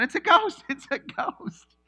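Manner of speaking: high, loud hammed-up scream of fright; mock panic. Terrified disciples seeing Jesus, hammed-up shriek. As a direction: shriek like you've seen a ghost. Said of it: "they start freaking out".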